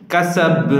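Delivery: কাসাব with an echoing bounce on the final ব; qalqala. Shown as a demonstration. Teacher shows qalqala on the final letter, echoing. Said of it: "গম্ভীর স্বরে উচ্চারণ করতে হবে".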